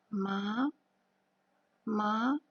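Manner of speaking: Ma rising second tone. Shown as a demonstration. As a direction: lift "ma" upward like a question.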